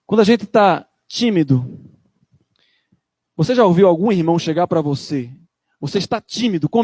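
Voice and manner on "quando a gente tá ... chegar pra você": ordinary delivery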